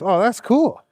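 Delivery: normal talking voice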